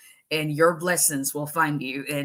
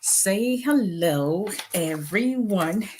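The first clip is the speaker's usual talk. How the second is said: slow coaxing; 3 semitones higher, much slower than usual. Real, not acted. Coaxing viewers to greet.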